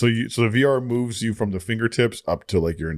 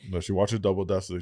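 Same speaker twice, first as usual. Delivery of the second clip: usual speaking voice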